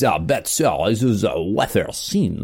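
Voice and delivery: put-on lightly done French waiter accent, slow; hint of a snooty French waiter, French accent; mock French accent. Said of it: "I was there speaking like this".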